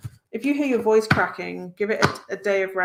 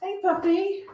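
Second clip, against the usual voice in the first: high pet voice; 11 semitones higher than usual. Real, not acted. Greeting the dog.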